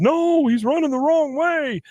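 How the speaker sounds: high, slow alarmed yell; yelling from stands. Retold or imitated. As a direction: yell it down at the field.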